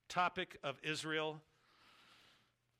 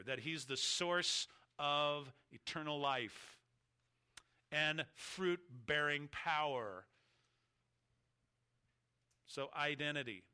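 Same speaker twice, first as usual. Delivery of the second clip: ordinary talk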